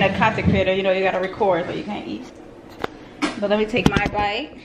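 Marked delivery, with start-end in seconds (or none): none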